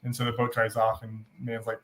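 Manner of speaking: ordinary delivery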